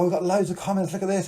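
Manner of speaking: ordinary talk, nothing marked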